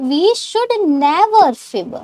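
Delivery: very slow, word by word; slow model sentence. Shown as a demonstration. Teacher dictating a sentence slowly to students. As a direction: spell it out slowly for learners.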